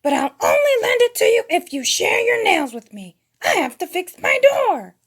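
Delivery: high, loud odd character voice; high squawky dealmaker; Gonzo. A narrator's reading. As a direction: bargain in a high loud cartoon voice. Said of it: "he said".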